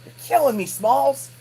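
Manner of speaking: high, loud catchphrase cry; mock exasperation. Vlogger teasing a viewer who almost bought one, mock exasperated. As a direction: yell it in hammed-up despair.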